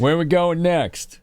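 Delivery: high, slow echo of the clip voice; imitating the alert voice. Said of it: "echoing the alert clip".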